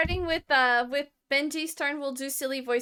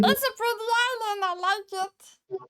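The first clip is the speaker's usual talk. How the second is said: mock gushing; 9 semitones higher than usual. Fawning praise of a card.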